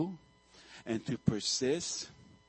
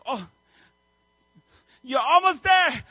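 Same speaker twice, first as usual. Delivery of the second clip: mock cheer, much higher and louder; encouraging call; 11 semitones higher, 10 dB louder than usual. Viewer rooting for the hatching chick, loud mock cheer. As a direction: egg it on like a fan.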